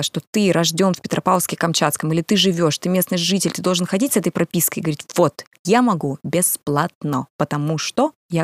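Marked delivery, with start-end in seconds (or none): none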